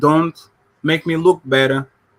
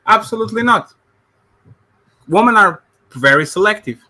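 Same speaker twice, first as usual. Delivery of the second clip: louder, higher, emphatic no; 5 semitones higher, 4 dB louder than usual. Shown as a demonstration. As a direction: hit it hard with conviction. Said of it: "No, I'm joking".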